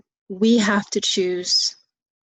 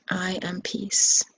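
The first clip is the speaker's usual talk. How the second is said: slow, drawn-out "I am peace"; slow mantra; slower than usual. Shown as a demonstration. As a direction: intone it gently and slowly. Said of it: "Or you can say it in English".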